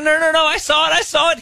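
put-on high, hurried voice of a protesting kid; mimicked child's high-pitched protest; imitated insistent nephew. Nephew insisting he saw the goal.

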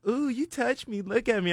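high, squeaky imitation of a strung-out woman; mock crackhead woman. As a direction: pitch it way up like the spaced-out girl. Quoting the woman.

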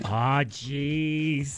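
man doing a woman's voice, low; worried office lady groan. Pretend. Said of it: "from an office lady".

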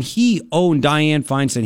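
ordinary delivery

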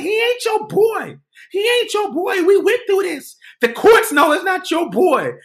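high, loud, drawn-out imitation of an angry woman; retold shrieky rebuttal; acted-out quarrel yelling. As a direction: shout him down in a high voice. Woman shouts back in quarrel.

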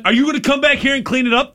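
put-on yelling at the boy to come back and clean up, demanding; calling out. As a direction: call them back, demanding. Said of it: "and I say to him".

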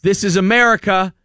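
angry man yelling at a driver, indignant; retold yelling. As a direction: punch out this is America indignantly. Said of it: "screaming at the".

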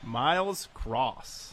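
slow, stretched game show announcement; drawn-out winner reveal. Acted. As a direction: draw out the winner's name. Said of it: "You're the game show host".

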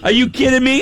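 high, shouted indignation; hammed-up indignant yell; mock outrage. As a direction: blow up in disbelief. Outraged retort, retold.

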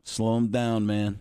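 low, clipped-off football coach talk; Jon Gruden. Acted. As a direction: gridiron coach impression. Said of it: "Gruden used to say".

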